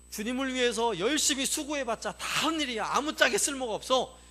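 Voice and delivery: loud, high, exasperated complaint; acted grumbling. Israelites complain serving God is useless, exasperated grumbling. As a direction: imitate fed-up people protesting loudly.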